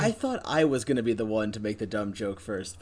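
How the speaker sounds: usual speaking voice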